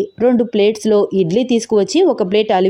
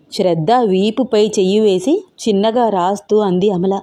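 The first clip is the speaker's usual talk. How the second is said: ordinary delivery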